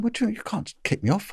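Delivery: high, startled protest at the order; indignant protest. Retold or imitated. Told he is off the case, he protests high and startled. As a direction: squeak it out in disbelief.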